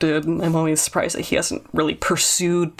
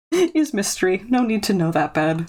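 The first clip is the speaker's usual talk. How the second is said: normal talking voice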